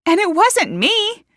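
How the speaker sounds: high, loud, slow denial; emphatic denial. A narrator's reading. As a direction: insist on innocence. Accused woman denies guilt.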